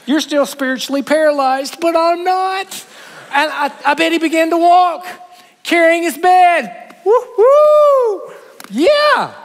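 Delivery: high, loud, gleeful whoop; joyful celebration. Acted. Preacher voicing the healed man, high and gleeful whoop.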